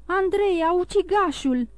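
high cry of alarm. A narrator's reading. One of the girls recognises the murderer, crying out in alarm. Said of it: "strigă una dintre fete".

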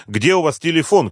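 high, pressing demand; urgent shout. A narrator's reading. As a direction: call out in a hurry. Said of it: "кричала Римма".

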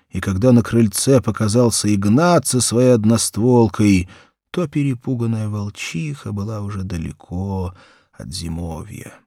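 ordinary delivery, nothing marked

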